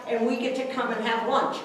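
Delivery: ordinary delivery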